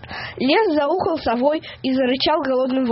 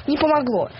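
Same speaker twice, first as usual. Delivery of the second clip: plain reading voice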